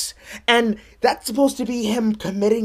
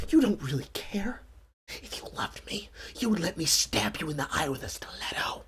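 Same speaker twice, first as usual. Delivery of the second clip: breathy girlfriend whisper, unvoiced; mock whisper; 7 semitones lower, 13 dB quieter than usual. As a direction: whisper it like a clingy partner. Mocking girlfriend's whisper quote.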